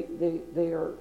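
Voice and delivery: imitating soft, quiet talk; hushed mock-humble voice; soft speech. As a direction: drop to a soft hush. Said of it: "they talk with a very soft speech".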